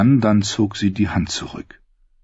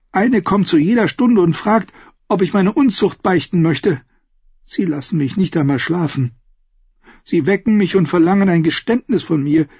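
lightly voiced indignant young queen; a touch higher, complaining bitterly; 7 semitones higher than usual. (junge Königin, leicht empört gesprochen)

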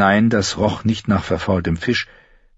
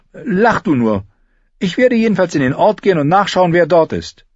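put-on higher defiant girl's voice; Arya's voice; 11 semitones higher, 4 dB louder than usual. Girl brushes off his laughter, higher defiant voice.

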